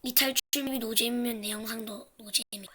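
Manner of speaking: unvoiced closing whisper. Real, not acted. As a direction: whisper it to the camera. Closing aside to camera.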